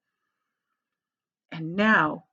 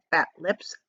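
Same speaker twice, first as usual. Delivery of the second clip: plain reading voice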